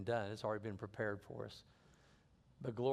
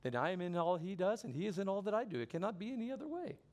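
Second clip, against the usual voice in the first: high, lilting mock Indian accent, climbing higher; put-on Indian accent, rising to the end; imitated Indian accent; 7 semitones higher than usual. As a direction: quote him in an amateur Indian accent. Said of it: "pardon my poor accent".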